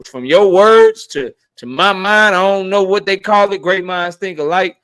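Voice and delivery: riding a burst of excitement; excited hype. Real, not acted. Host hyping up a viewer's super chat, excited and high.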